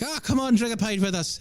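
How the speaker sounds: high, bright-pitched mock Irish invitation; a hint of a jolly pub Irishman, voiced high; cheery Irish drinker. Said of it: "They're like".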